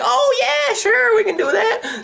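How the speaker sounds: very high, chirpy put-on clerk; eager store staff. Store staff agree to ship it, high mock-eager voice. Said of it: "And they're like".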